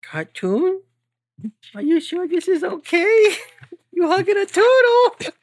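tiny companion panicking at the turtle hug; squeaky alarmed voice. Acted.

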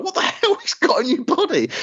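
high mock outrage; Ben impression. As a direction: scoff in disbelief. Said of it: "the way he was like".

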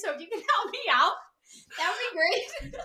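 helplessly amused, words breaking into laughs; laughing. Real, not acted. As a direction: talk through laughter at your own flop. Laughing at own failed accent.

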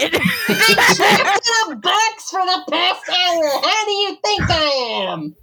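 high, exasperated character voice; irritated. Acted. Trapped toy answers River from the box, irritated. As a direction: complain in irritation, pitched high. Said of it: "Oh, you sound irritated".

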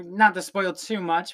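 ordinary delivery

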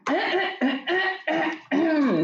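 long, exaggerated throat clear; showy throat clearing. Host preparing to begin, showy throat clearing. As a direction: clear the throat theatrically before a speech.

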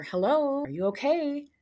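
a touch of a colleague's worried call. Retold or imitated. Colleague finding her crying, mildly concerned voice. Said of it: "low voice I recognized".

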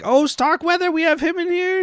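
very high-pitched excited mimicry. Podcaster mimics the movie's receptionist greeting someone, excited. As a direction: imitate a thrilled receptionist in a squeaky-high voice. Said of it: "And she's all excited".

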